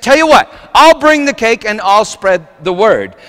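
high, loud acted-out enthusiasm; eager offer. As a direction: imitate a diner man taking charge of a party. Diner man joins party plan.

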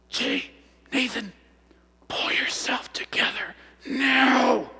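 slow, forceful mom's stage whisper; whispered reprimand, stretching the last word; fierce whisper. Retold or imitated. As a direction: scold a child through a harsh whisper in church. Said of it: "That's a pretty powerful whisper".

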